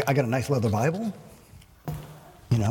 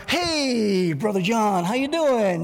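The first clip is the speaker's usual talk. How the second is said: bright, higher put-on church smile voice; fake cheerful greeting; 7 semitones higher, much slower than usual. Pastor mimicking a churchgoer's fake friendly hello. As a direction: greet like nothing's wrong.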